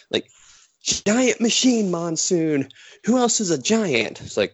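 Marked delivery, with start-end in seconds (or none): 0.8-4.2 high, slow, incredulous; imitating an outraged commentator; hammed-up disbelief; mock exasperated Heenan.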